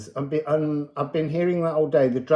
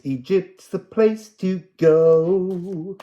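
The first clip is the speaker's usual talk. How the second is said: oracle pronouncement; 5 semitones higher, 6 dB louder, slower than usual. Acted. Channelled message continues.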